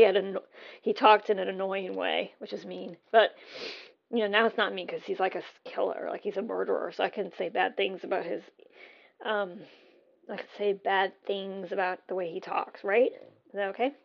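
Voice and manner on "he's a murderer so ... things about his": ordinary talk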